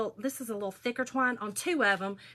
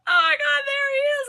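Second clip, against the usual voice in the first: high, loud, drawn-out tearful wail; hammed-up fangirl sob, pitched way up; imitated crying fan; 9 dB louder than usual. Imitating a concert fan as the singer comes out, wailing in tears. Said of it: "she was crying".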